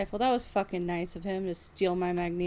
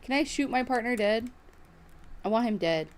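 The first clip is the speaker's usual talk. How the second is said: ordinary talk, nothing marked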